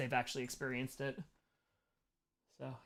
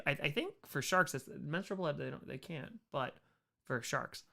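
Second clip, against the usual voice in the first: normal talking voice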